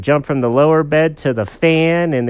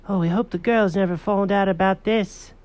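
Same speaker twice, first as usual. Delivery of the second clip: high, fretful character voice; anxious high-pitched storybook critter; worried little animals; 9 semitones higher than usual. Acted. Animals hope not caught.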